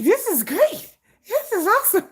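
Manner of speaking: high giddy squeal; excited squeal. Retold or imitated. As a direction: gush over it, delighted.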